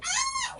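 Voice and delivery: very high thin creature cry; pterodactyl screech. Shown as a demonstration. Teacher imitating winged dinosaur for class, high screech.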